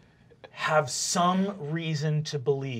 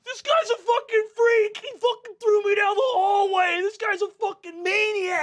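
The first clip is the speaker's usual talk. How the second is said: loud, high-pitched tearful outburst; mock crying; 8 dB louder than usual. Thrown bully complains about being hurled down the hallway, crying and shouting. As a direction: wail it out in a high, upset shout. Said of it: "Are you crying?".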